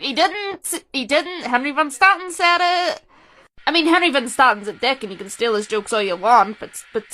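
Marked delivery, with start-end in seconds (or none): 0.0-3.0 high, pleading he didn't; exasperated correction. Acted. Fan correcting people about who said the line first, exasperated and high. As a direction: correct them as if it's obvious.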